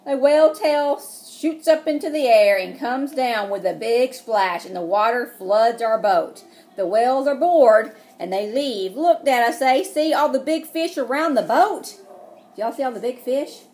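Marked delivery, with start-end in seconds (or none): 0.1-12.0 loud dramatic read-aloud; excited storybook narration; reading to children. Acted. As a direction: make the splash big. Story narration, whale splash.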